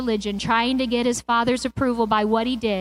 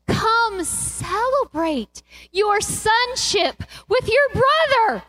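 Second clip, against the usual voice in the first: impassioned father; 8 semitones higher, slower than usual. Acted. Father pleading with elder son.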